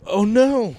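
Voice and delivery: high, loud drawn-out lament; mock dismay. Podcaster learning the five-dollar tee is missing, mock dismay. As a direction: announce it like a small catastrophe.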